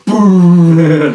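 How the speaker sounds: high, loud booing; crowd boo. Retold or imitated. Friend imitates the crowd booing the logo, loud. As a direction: boo like a whole rival crowd.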